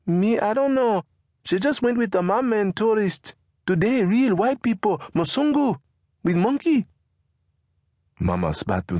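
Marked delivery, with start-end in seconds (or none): 0.1-6.9 put-on high, eager boy's voice; a son telling his mother gossip; young beggar boy reporting, pitched high.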